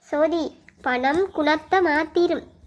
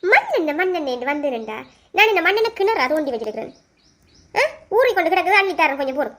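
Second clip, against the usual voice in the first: sarcastic scolding; 3 dB louder, faster than usual. Acted. Sister refusing to give kerosene, sarcastic and angry. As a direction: scoff sharply at the request.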